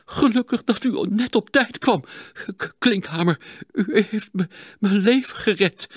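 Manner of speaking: a moved, faltering voice; stammering, moved. A narrator's reading. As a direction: stammer out gratitude. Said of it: "stamelde de dokter ontroerd".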